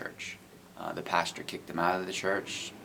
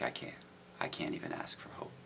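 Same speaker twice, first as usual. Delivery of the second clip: much lower, near-whispered despair; despairing hush; 12 semitones lower, 10 dB quieter than usual. Retold or imitated. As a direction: let the words fall to a broken whisper. Despairing man quoted.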